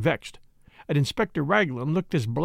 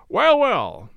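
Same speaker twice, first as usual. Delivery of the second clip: louder, higher, hearty and breezy; 6 semitones higher, 5 dB louder than usual. Acted. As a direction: boom it out cheerfully to change the subject. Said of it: "breezily in a louder voice".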